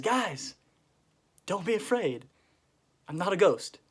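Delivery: acted insistent reassurance; calling reassurance. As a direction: call across to frightened friends. Acts Jesus calling to frightened disciples.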